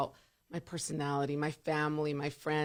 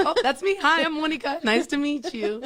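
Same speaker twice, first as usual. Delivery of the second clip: chirpy high, louder self-introduction; bright greeting; 10 semitones higher, 6 dB louder than usual. Retold or imitated. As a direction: greet them brightly, pitched up. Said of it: "And I'm like".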